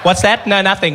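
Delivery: caught-out office gossip, hammed up; mock office worker. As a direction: break from confiding into a loud innocent act.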